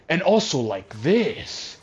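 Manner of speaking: slow, high, swooping ham-actor voice. Retold or imitated. Streamer mocking how the actor always talks, bombastic and swooping. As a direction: stretch the words and swing the pitch dramatically. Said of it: "He talks like this".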